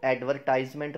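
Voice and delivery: "एडवर्टाइजमेंट" with a sounded R and "tize"; ad-veR-TIZE-ment, R and "eye" vowel; wrong, R pronounced. Shown as a demonstration. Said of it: "आर को भी pronounce करते हैं".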